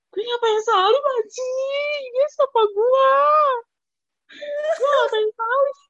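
high, drawn-out mock-crying wail; mortified wail. Passenger to self after kissing the driver's hand, mortified wail.

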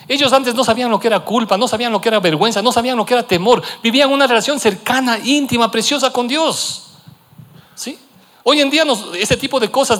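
high, loud, driving sermon voice; impassioned preaching. Acted. Pastor preaching to the congregation, impassioned and loud. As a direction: preach it out to the church.

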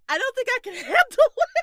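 laughing. Real, not acted. Laughing at embarrassing confession.

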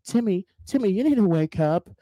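high, sing-song nagging parent voice; imaginary caretaker coaxing Timmy awake, high and sing-song; mock wake-up call. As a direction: call him up out of bed like a fussing mom. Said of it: "No one is gonna show up and tell you".

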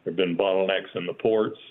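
usual speaking voice

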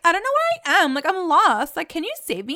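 high-pitched mock panic; acted-out, high pleading; freaking out. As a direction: imitate a lost girl's panicked cries for help. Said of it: "she starts freaking out. Like, you can hear her voice".

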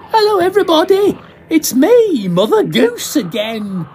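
very high, loud, fast dame voice; pantomime dame greeting. Acted. Drunk actor greeting the audience as a pantomime dame. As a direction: hail the crowd as Mother Goose.

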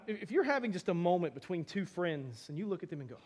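normal talking voice

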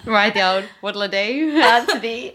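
playful mock-Aussie invitation; Australian accent. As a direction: put on a beachgoing Australian twang. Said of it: "in an Australian".

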